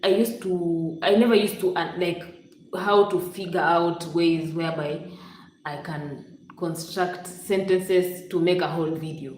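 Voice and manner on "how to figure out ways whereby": usual speaking voice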